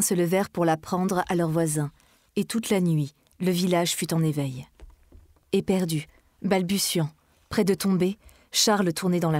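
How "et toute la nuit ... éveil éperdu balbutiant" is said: ordinary delivery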